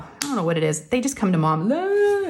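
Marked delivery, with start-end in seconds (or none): 1.7-2.3 put-on whiny kid sound. Kids come to mom whining.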